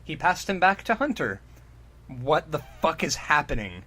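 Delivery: usual speaking voice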